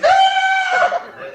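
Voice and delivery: high, slow, loud yell; shouted. Pretend. Anarchist comic yelling at the crowd. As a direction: bellow it in a drawn-out shriek.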